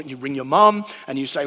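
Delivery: usual speaking voice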